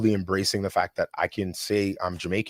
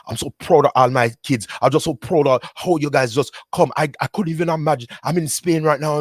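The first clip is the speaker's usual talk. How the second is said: put-on proud Jamaican dad, pitched higher, heavy Jamaican accent; a proud father's heavy Jamaican accent, higher and louder; dad's heavy Jamaican accent; 7 semitones higher, 5 dB louder than usual. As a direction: imitate your father's heavy Jamaican accent, bursting with pride. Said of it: "heavy Jamaican accent".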